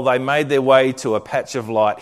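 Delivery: ordinary talk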